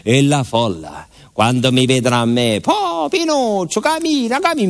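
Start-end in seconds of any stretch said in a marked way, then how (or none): 2.6-4.7 crowd urging. Retold or imitated. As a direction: push him forward, high and insistent. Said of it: "mi spingevano".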